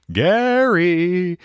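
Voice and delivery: high, loud drawn-out Gary; Gary clone voice. Retold or imitated.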